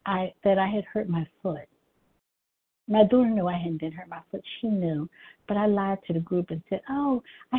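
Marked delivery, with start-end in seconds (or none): none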